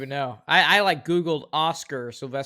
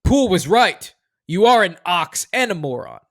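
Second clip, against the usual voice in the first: loud movie read; 5 semitones higher, 10 dB louder than usual. Shown as a demonstration. Host reads quote loudly.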